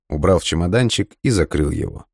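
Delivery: ordinary delivery, nothing marked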